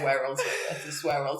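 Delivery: ordinary talk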